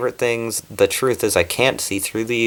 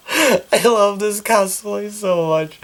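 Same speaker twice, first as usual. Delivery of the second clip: tearful joy; 10 semitones higher, slower than usual. Real, not acted. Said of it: "I'm, like, literally tearing up".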